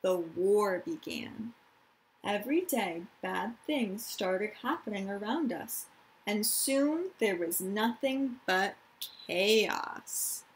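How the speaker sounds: calm storytime reading; reading to children. Acted. Teacher reading a picture book to her class, gentle storytime.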